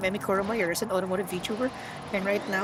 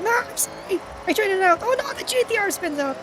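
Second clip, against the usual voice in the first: panicked; 9 semitones higher than usual. Real, not acted. Streamer apologizing to the lead car, panicked. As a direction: blurt it out in real panic.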